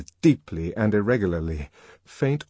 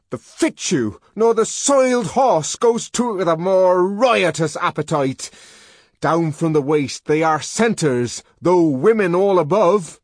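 high, loud ranting verse; the savage raving Shakespeare, high and loud; 10 semitones higher, 7 dB louder than usual. Acted. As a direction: hurl the lines like a madman. Savage rants Shakespeare in fury.

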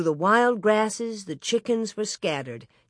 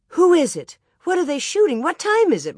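high, louder string of questions; urgent questioning; 9 semitones higher, 5 dB louder, faster than usual. Acted. Ma woken by shooting, urgently questioning. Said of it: "Ma wanted to know".

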